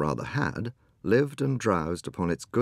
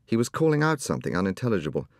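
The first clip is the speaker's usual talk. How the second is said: plain reading voice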